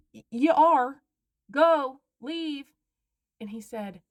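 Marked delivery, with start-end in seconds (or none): 0.1-2.7 loud, high barked order to get out; commanding shout. Retold or imitated. Homeowner ordering a trespasser out, shouting. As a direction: snap it like a final warning.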